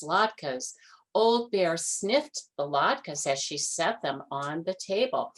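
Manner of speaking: ordinary delivery, nothing marked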